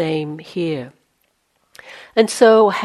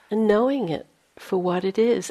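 ordinary talk, nothing marked

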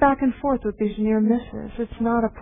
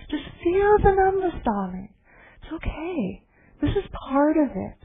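put-on Ruth Denison German accent; 7 semitones higher than usual. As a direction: coo the reassurance in a German accent. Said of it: "I always hear the teaching in a German accent".

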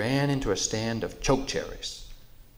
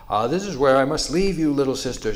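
louder, higher and faster beast voice; big buffalo voice; 5 semitones higher, 9 dB louder, faster than usual. Acted. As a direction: boom it like a friendly giant. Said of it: "said Buffalo".

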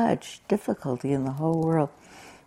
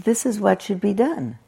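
higher, ringing declaration; unequivocal; 7 semitones higher than usual. Retold or imitated. Teacher quoting the sutta to listeners, unequivocal. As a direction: state it as beyond doubt. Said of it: "the unequivocal ring of that".

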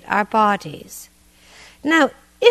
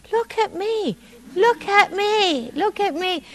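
imitating little children; 9 semitones higher than usual. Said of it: "their mantra".

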